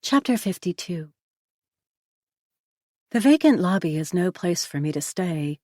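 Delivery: ordinary delivery, nothing marked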